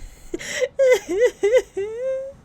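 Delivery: squealing laugh breaking to a fake sob; high laugh-cry. Real, not acted. Player mock-crying after the scary scene, high laugh-wail. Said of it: "Start crying".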